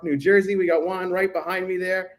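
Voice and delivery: ordinary talk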